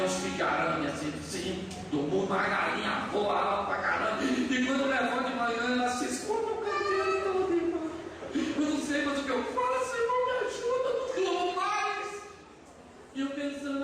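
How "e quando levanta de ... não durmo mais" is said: high, hammed-up wailing complaint; imitating tearful woman. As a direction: plead for help in a mock-crying, higher voice. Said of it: "e ela chorando". (imitando mulher chorosa, lamento agudo e exagerado)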